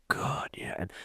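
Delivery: hushed whisper, almost unvoiced. Real, not acted. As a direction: whisper it like a confession. Lamenting a spoiled car name.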